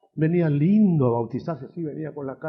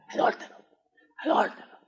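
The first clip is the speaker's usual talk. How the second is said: adúltero called from far off, laughing; distant echoing cries; 9 semitones higher than usual. Acted. Preacher voicing John still shouting as he is led off. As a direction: call it out as if fading away.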